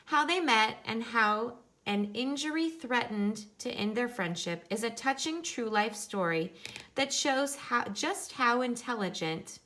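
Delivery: picture-book reading voice; reading to children. Acted. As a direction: read it out brightly for the class. Reads picture book aloud to class.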